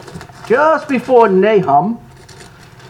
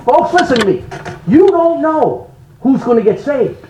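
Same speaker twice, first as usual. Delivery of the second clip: usual speaking voice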